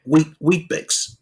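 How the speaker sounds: high, baffled mock customs officer; mock border guard. Imagined guard puzzling over kangaroo and Weet-Bix, high mock bewilderment.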